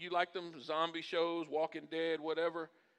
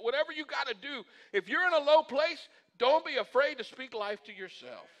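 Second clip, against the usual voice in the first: raised, forceful exhortation; emphatic preaching; 8 semitones higher, 5 dB louder than usual. Acted. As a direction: urge the congregation on, high and loud.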